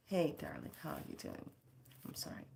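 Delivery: hushed sweet aside to someone nearby; whispered aside. Real, not acted. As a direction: duck away and whisper a hello.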